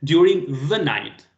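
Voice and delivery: slow, with "the" leaned on firmly; "the" set apart and emphasized; stress on "the". Shown as a demonstration. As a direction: punch "the" in a slowed-down correction. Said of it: "That is definite".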